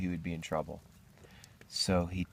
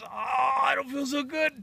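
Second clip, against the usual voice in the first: high, strained complaint; acted queasy groan; 4 dB louder than usual. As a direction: wail as the next egg comes on. Second labour starts.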